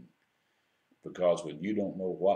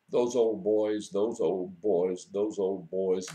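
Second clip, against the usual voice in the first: put-on slow, louder, hurt child's protest; angry tearful complaint; 5 dB louder, slower than usual. Little girl crying to her father, angry and hurt. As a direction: retell a little girl's angry wail, louder and drawn out. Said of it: "and she was angry".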